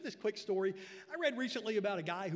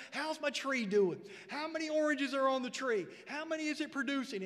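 higher, louder eager questions; eager boy; 7 semitones higher, 8 dB louder than usual. Retold or imitated. Boy calling grandma about his tree, eager and loud. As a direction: ask like an excited kid on the phone.

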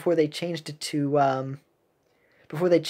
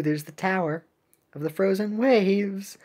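usual speaking voice

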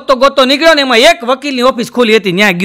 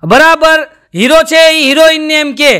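ordinary talk, nothing marked

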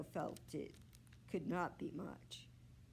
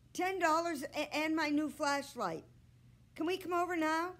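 put-on higher, louder pleading boy's voice; eager young boy; 9 semitones higher, 5 dB louder than usual. A narrator's reading. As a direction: press the offer like an anxious kid. Boy Henry eagerly bargaining.

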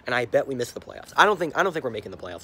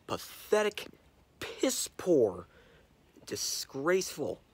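disgusted whisper; 3 semitones higher, 4 dB quieter, much slower than usual. Real, not acted. Ranting about Yankees loss.

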